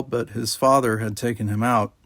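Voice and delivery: usual speaking voice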